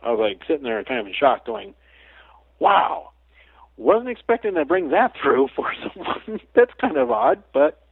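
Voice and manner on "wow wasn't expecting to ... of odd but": high, stunned wow; retold amazed reaction; surprised. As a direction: caught off guard, voice jumping up. Shocked reaction quoted to himself.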